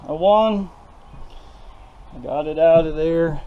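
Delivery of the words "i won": triumphant. Real, not acted. Triumph after removing generator.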